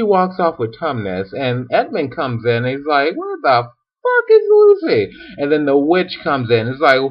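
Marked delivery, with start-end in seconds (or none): 3.2-5.1 very high, put-on squawk; hammed-up high-pitched confusion; mock bewilderment. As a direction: demand it in comic alarm. Acted Edmund looks for sister.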